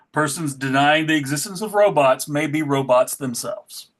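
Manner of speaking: ordinary talk, nothing marked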